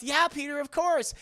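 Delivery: high, bright-eyed agreeable voice; eager girl. Retold or imitated. Ursula agrees to fetch Peter milk, high eager voice.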